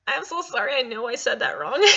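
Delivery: usual speaking voice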